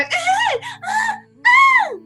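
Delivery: high piercing anime scream; Vanille scream. Retold or imitated. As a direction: shriek like a scared anime girl. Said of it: "she'd run out a monster and go".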